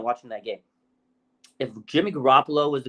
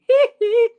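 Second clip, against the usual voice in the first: very high, loud falsetto hee; 8 dB louder than usual. Retold or imitated. Joking about the benched cornerback with a falsetto hee. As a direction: squeal a quick pop-star hee. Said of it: "that's my [name] impression".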